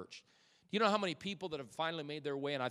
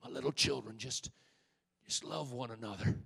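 put-on old apostle's voice; 4 dB quieter than usual. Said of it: "gravelly voice".

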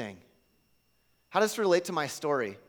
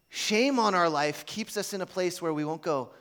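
ordinary talk

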